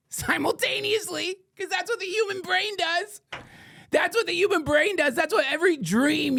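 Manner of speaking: gleeful squeak. Real, not acted. Gleeful point about the brain.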